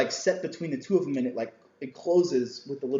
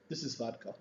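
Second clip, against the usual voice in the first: deadpan; 4 semitones lower, 13 dB quieter than usual. Retold or imitated. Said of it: "totally straight face".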